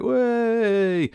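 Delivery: high mock cheer of a crowd. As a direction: cheer it like an impressed bus crowd. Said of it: "everyone was like".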